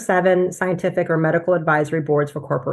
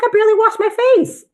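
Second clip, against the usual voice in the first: very high, loud mock wail; exasperated protest; 5 dB louder than usual. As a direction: complain in an exaggerated squeaky pitch. Acted protest at long skincare routine.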